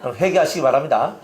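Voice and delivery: ordinary talk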